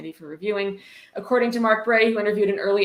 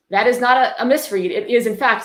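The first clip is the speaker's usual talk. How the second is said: louder, pressing every word; emphatic insistence; 4 semitones higher, 7 dB louder than usual. Real, not acted. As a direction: hammer home that it's no misreading. Mocking a badly written sentence.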